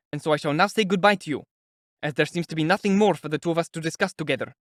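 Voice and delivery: indignant and pushed, just short of shouting; irritated, near shout. A narrator's reading. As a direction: lay down an exasperated ultimatum. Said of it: "he almost shouted".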